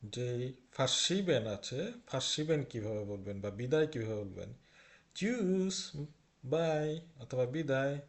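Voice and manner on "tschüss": "Tschüss" drawn out, higher; long vowel. Shown as a demonstration. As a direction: stretch the ü in "Tschüss". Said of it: "লম্বা করে উচ্চারণ হবে". (লম্বা করে টেনে "Tschüss")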